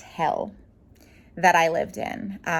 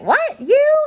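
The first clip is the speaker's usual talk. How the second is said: a sharp, much higher and louder double take; mock shocked disbelief; 8 dB louder than usual. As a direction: act stunned at the news. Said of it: "people were like".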